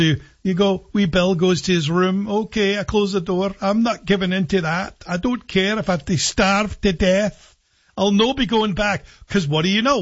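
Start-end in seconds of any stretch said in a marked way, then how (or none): 0.9-9.1 put-on stubborn Scottish child, slightly higher; Scottish accent. Sulking child shut in his room refuses to give in, in a Scottish accent. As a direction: dig your heels in as a wee Scottish boy. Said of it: "in a Scottish accent".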